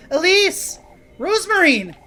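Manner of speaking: Elise shouting, villain purring; voiced villain scene. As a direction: menace against defiant cries.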